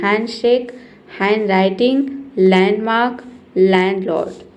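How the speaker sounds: हैंडशेक, हैंडराइटिंग, लैंडमार्क, लैंडलॉर्ड as "han-" and "lan-"; silent D. Shown as a demonstration. Teacher drills reading only "han", silent D. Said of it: "डॉ को pronounce नहीं करना है".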